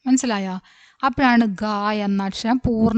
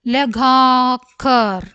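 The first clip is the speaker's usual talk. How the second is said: slow, stretched middle vowel; syllable by syllable; 4 dB louder, much slower than usual. Shown as a demonstration. Teacher modelling a word for learners, syllable by syllable. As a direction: draw the word out in separate syllables.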